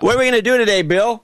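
lightly acted eager staffer, pitched high; high-pitched mock workplace banter; mock think-tank staffer.

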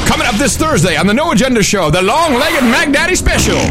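high, fast hype announcer voice; wrestling promo. Shown as a demonstration. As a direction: boom it out like a wrestling hype man. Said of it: "Let me do the promo".

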